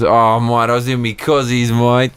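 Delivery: playful Australian yarn, increasingly high; mock Aussie accent. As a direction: tell it as an excitable Aussie caricature, rising to the end. Aussie act answering cozies question.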